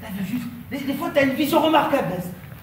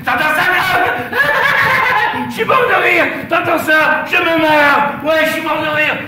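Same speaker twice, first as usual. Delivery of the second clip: sarcastic loud laughter; 4 semitones higher, 5 dB louder than usual. Acted. Jeering back in a quarrel.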